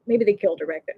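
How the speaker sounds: conspiratorial whisper; hushed mock-sinister aside. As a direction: whisper the dark joke like a secret. Dark joke, then "just kidding.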